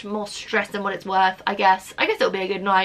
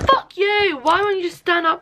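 angry outburst; 8 semitones higher than usual. Real, not acted. Swearing at object that won't stand.

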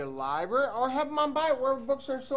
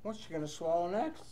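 ordinary delivery, nothing marked

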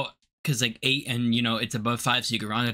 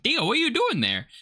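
surprised; 9 semitones higher than usual. Retold or imitated. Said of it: "I was like".

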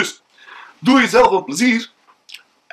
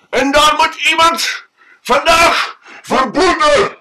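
higher, louder furious rant; angry drunk man; 6 semitones higher, 5 dB louder than usual. Acted. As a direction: rage about being locked out. Drunk man tells his woes.

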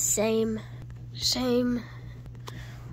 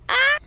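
sudden high loud shriek; startled scream; 13 semitones higher, 8 dB louder than usual. Acted. Feels watched in the dark, startled scream. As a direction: shriek at something unseen.

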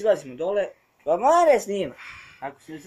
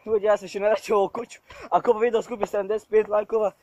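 shaken and excited; 4 semitones higher, 6 dB louder than usual. Real, not acted. After escaping the house.

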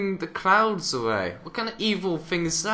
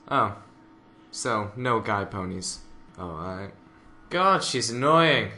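normal talking voice

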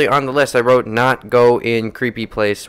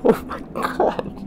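shaken whisper; slower than usual. Real, not acted. After jump scare.

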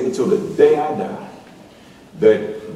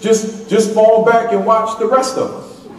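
usual speaking voice

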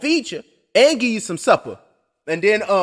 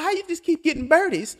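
wife; 8 semitones higher, 6 dB quieter than usual. Retold or imitated. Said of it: "She like".

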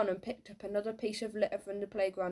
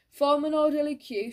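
high, loud order to the crowd; dinner lady's shout; 6 semitones higher, 7 dB louder than usual. A narrator's reading. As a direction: call it out to unruly children. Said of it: "shouted the dinner lady".

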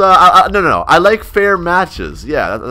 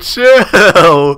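louder word breaking into laughter; laughing readout; 3 semitones higher, 4 dB louder than usual. Retold or imitated. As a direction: read it out and crack up. Laughs while reading out the message.

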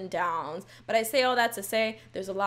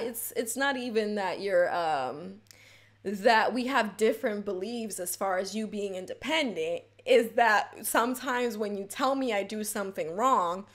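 normal talking voice

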